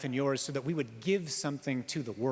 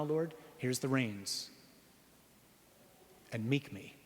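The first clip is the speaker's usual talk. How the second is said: slow, softer surrender to God; hushed prayer; 4 dB quieter, slower than usual. Real, not acted. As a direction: hand over the reins quietly, slowly. Quiet prayer to the Lord.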